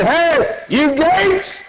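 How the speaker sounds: high ringing call; herald's call. Acted. Heavenly herald calling the gates, high and ringing. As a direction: summon the gates. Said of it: "a voice comes that said".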